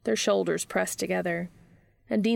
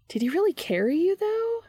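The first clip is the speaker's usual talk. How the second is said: high-pitched conspiratorial question; pry eagerly in a high voice, as if sharing a secret; Charlie; 13 semitones higher, 4 dB louder than usual. A narrator's reading. Charlie digs for a juicy detail, conspiratorial high voice. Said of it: "Charlie asks conspiratorially".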